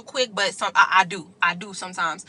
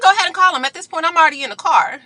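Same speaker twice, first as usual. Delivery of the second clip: higher, louder "go ahead and call them"; raised, taunting reply; defiant dare; 6 semitones higher, 3 dB louder, faster than usual. Retold or imitated. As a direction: dare her, unbothered. Daring her to call police.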